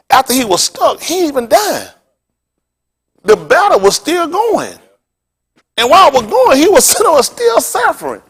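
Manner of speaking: high, impassioned preaching voice; preaching at full force. Acted. Preacher telling the congregation the story with fervour. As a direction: lift the story into a fervent preach.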